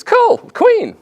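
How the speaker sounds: loud, high mock excitement; hammed-up giddy fan; imitating thrilled fan. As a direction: blurt it out like a delighted drunk. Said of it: "the guy sees the Queen and thinks".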